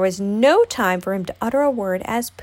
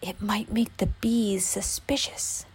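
slow, hushed, unvoiced aside; whisper; 7 dB quieter, slower than usual. A narrator's reading. Pooh confides in Piglet about the bees, whispering. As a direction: whisper it as a secret afterthought. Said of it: "he whispered to Piglet".

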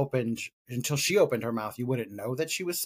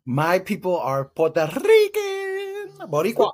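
drawn-out proud announcement, much higher and louder; extra proud; 8 semitones higher, 7 dB louder than usual. Pretend. Cohost showing off heritage with over-the-top flair. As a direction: ham up the pride in your roots. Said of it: "So much extra on it".

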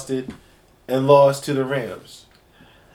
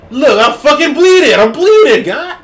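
higher, louder hammed-up bleeding quarterback; mock Tom Brady; 10 semitones higher, 9 dB louder than usual. Voicing a bleeding quarterback for friends, hammed up. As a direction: play the bloodied star yelling in pain.

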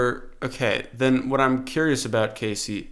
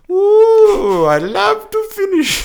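very high, slow put-on Finnish; hammed-up high Finnish with a long ooh; mock Finnish accent; 8 dB louder, much slower than usual. Doing the game line his own way, in mock Finnish accent. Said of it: "let me do it my way".

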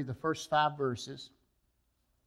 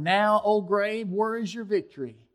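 louder and higher, proclaiming victory over death; triumphant shout; 6 semitones higher, 9 dB louder than usual. Acted. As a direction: declaim it like a victory cry.

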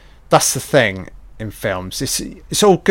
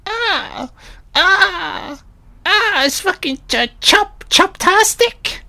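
much higher, stuttering cartoonish voice; squeaky Dennis; 3 dB louder, slower than usual. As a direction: go high and squeaky, stammering. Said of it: "Dennis is like".

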